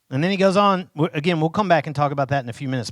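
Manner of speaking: normal talking voice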